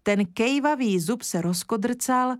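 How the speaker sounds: usual speaking voice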